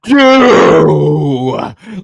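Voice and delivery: loud, drawn-out cry of dismay; appalled disbelief. Real, not acted. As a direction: stretch it out in scandalized protest. Host shocked by guest's opinion.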